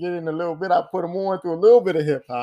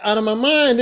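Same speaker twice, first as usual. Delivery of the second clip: ordinary delivery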